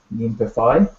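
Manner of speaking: "Min pe phi" going up at the end on "phi"; rising question inflection. Shown as a demonstration. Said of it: "your voice goes up at the end".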